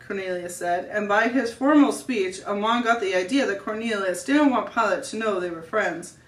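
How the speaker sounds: plain reading voice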